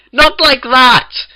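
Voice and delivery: screech. A narrator's reading. Twilight screeches at the bakers' saucy look. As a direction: screech it in mortified protest. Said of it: "Twilight screeched".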